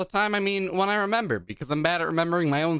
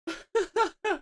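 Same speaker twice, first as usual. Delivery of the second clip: high laugh; 3 dB quieter than usual. Real, not acted. Finds the karaoke track.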